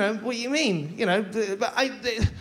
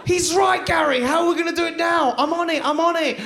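high, raised flustered voice; hammed-up panicky men; comic panic; 8 semitones higher, 4 dB louder than usual. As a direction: fret aloud to your mate in alarm.

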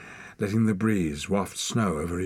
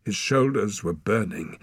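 usual speaking voice

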